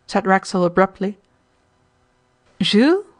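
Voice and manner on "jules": sharp high rising "Jules?"; feigned surprise at the name; startled question. A narrator's reading. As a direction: caught off guard by the name.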